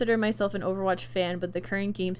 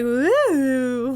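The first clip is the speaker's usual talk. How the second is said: voice crack impression; 4 semitones higher, 7 dB louder than usual. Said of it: "My voice went like".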